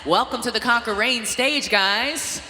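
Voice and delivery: loud, high stage emcee call; booming pumped announcer. Acted. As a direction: pump up the crowd and athletes. Emcee hyping bodybuilding stage.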